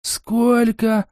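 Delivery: high-pitched stunned question; astonished. A narrator's reading. Яхиль hears the size of the bet, astonished. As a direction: ask it pitched right up in shock. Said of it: "выпучил глаза Яхиль".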